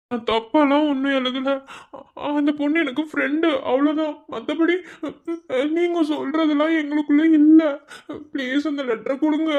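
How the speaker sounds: a nervous junior begging the senior; pleading timid student. Acted. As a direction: plead meekly to get the letter back. Timid student begs for letter.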